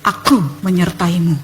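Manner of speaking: low, assured divine voice; God's answer. Retold or imitated. God reassures Lala, low calm voice. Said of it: "Tuhan kembali menjawab".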